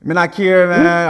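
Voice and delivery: tossing off a quick line in Jamaican patois; patois accent. Pretend. Answering the host's patois question in a playful Jamaican patois. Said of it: "You speak patois?".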